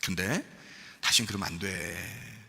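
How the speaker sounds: soft, low, tender pardon; hushed, kindly admonition; 예수님, very gentle. Acted. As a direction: forgive quietly, slowing on the last words. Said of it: "참 부드럽게 얘기했지만".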